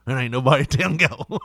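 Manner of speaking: ordinary delivery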